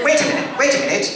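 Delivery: high, anxious wait a minute; fussy robot call. Acted. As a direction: call after him like a fretful butler robot. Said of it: "acting C-3PO scene".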